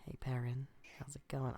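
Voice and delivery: soft hushed greeting. Acted. Sneaking up greeting the NPC.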